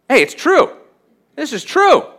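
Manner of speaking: high, bright-eyed mock realization; excited mock convert; mock discovery. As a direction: play the new believer suddenly getting it, up high. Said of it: "I was reading the Bible and I realized".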